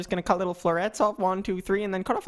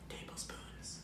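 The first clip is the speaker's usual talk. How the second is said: whispering; 19 dB quieter, slower than usual. Real, not acted. Cooking demo, whispered aside.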